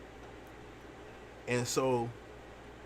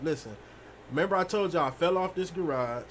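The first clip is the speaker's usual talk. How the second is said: ordinary delivery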